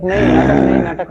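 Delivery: very low ghostly retort. Acted. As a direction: snap back like a deep spirit. Ghost voice between host lines. (गहरी भुतहा आवाज़ में)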